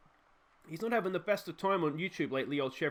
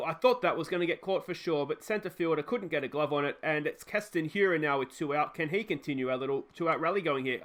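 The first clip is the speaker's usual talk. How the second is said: ordinary talk, nothing marked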